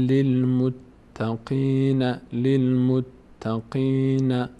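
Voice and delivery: للمتقین said very slowly, ending held long; slow demonstration. Shown as a demonstration. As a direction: draw للمتقین out for the learner.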